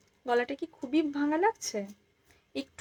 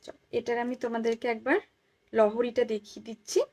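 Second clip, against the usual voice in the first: normal talking voice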